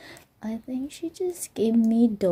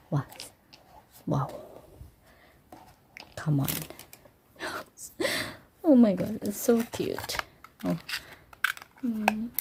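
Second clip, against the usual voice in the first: normal talking voice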